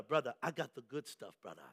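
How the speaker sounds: low, hushed Jamaican hustler; under-the-counter sales pitch, imitated Jamaican accent; rusty Jamaican accent. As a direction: confide it softly in a put-on Jamaican accent. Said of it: "a little rusty on my Jamaican ask, uh, uh, accent".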